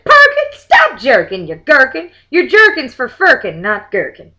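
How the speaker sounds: loud, high scolding yell; hammed-up angry father shout. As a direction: bellow at the boy.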